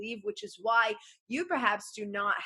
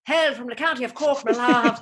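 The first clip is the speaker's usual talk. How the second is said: loud, higher mock Irish brogue; Irish accent; 3 semitones higher, 8 dB louder than usual. Shown as a demonstration. Claiming County Cork roots, in a loud mock Irish accent. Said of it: "though I am Irish".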